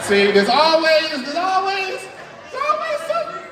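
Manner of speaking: high, loud mock exasperation; teasing. Real, not acted. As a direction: playfully scold the rebels. Teasing the group for skipping.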